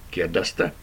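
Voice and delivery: plain reading voice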